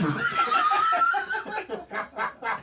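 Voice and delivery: loud, high cackling laugh; big burst of laughter. Real, not acted. Laughing hard while telling friends the story.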